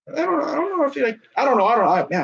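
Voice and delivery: stumbling over the words, flustered; tripping on each start, can't get it out; real stuttering. Real, not acted. Streamer loses the thread and stutters on stream. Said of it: "I'm stuttering like a bitch".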